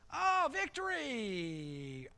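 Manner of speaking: high, long drawn-out cheer; mock triumph. Preacher acts out Israel winning, drawn-out triumphant cry. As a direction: stretch it out in acted celebration.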